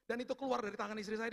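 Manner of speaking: usual speaking voice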